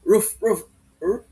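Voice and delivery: a hint of a barking dog; lightly voiced roof roof. Shown as a demonstration. Teacher imitating an ordinary dog barking, light. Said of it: "pag tumahol yan".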